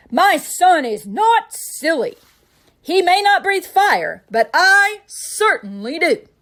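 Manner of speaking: loud, slow furious roar; angry roar. A narrator's reading. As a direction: stand up for your son, furious. Father stormed out of the house.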